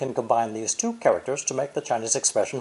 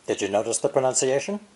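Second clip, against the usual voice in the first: normal talking voice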